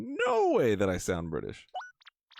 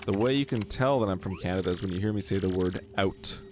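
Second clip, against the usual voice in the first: ordinary talk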